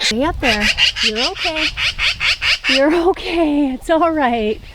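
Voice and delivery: high, gentle cooing. Real, not acted. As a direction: reassure the frightened birds. Calming fledgling and parent.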